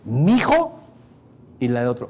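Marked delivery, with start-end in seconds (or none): 0.1-0.8 a hint of a mother calling mijo; mock mother calling. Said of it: "O al revés". (imitando a una mamá que dice mijo)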